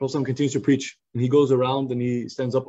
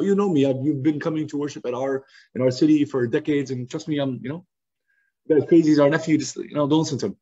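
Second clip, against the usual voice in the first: higher, mocking warning voice; mocking uncle; 4 semitones higher than usual. Abu Lahab warns pilgrims against his nephew, higher mocking voice. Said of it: "and he would say".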